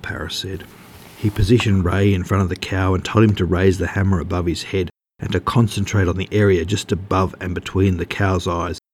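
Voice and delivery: plain reading voice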